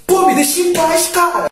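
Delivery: high, sudden mock plea; retold cornered yelp of protest; acted panicked outburst. Frightened outburst at suspected robber.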